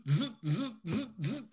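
mock vibration buzz, rising on each one; imitated phone buzz. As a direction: buzz like a phone going off on the nightstand. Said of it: "all I keep hearing is zzz".

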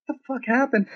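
high-pitched incredulous demand; reenacted alarmed question; retold shock. Stunned reply to shot friend.

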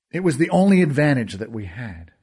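usual speaking voice

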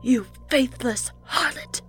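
spat insult. Acted. Fanatic patient spitting scorn at the nurse, slow. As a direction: spit the insult at her. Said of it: "Maureen spat".

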